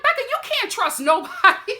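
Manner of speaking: half-laughing, urgent plea to a friend; laughing high plea. Real, not acted. Vlogger laughing as she warns Becca, pitched high.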